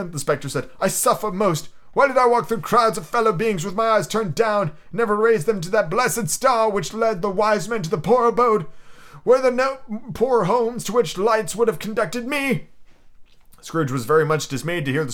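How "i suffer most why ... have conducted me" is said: ghost voice. Acted. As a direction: intone it like a chained spirit. Ghost laments.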